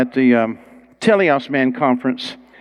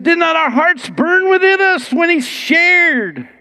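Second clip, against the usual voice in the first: slow and much higher, full of wonder; marveling disciples; 3 dB louder, slower than usual. Retold or imitated. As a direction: let each word glow with awe. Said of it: "they said".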